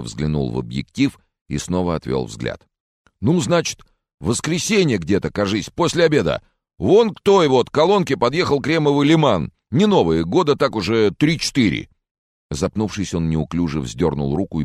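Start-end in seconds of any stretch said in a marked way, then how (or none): 3.2-11.9 high-pitched, awkward local man; rustic mechanic witness. Acted. Mechanic tells reporter what he saw, timid high voice. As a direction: pitch it way up like a timid workman on camera.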